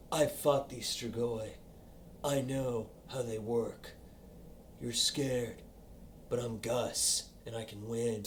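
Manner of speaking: low, hushed tough-guy voice; imitación de Gus. Retold or imitated.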